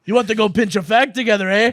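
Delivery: loud, hammed-up Scottish accent; mock Scottish. Offering a smoke in a loud mock Scottish voice. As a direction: push it out like a rowdy Scotsman. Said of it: "Oh, that hurt my throat".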